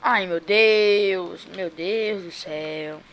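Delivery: slow, drawn-out pained groan; toothache. Real, not acted. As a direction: moan it out, tooth aching. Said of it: "meu dente tá doendo demais".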